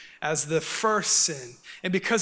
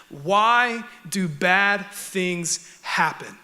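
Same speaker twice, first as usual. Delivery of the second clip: very slow, word by word; slow, deliberate, spaced-out delivery; weighty question; much slower than usual. Real, not acted. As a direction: pose the big question, drawing out every word. States the sermon's central question.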